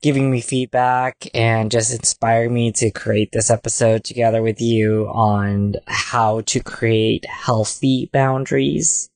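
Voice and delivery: ordinary talk, nothing marked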